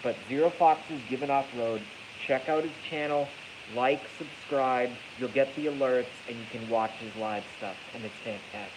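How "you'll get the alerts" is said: normal talking voice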